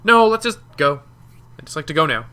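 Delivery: loud, high protest, voicing the character; acted-out character quote. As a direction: urgently insist on leaving, imitating him. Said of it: "but he's like".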